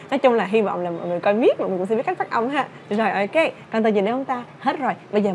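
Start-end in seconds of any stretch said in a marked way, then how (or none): none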